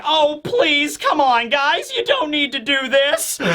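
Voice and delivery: high pleading plea; pleading Kobe. Retold or imitated. Kobe begging the fighters to stop, high pleading.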